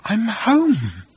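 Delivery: high, drawn-out dreamy sigh; blissful daze. Acted. Arthur deaf to news of Earth's demolition, blissful. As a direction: float it up high, lost in bliss.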